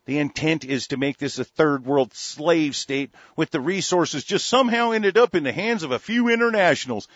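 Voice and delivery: ordinary delivery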